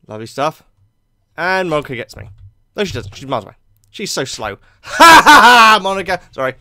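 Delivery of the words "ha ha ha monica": loud, high mocking "ha ha ha"; taunting laugh. Mock laugh taunting slow Monica.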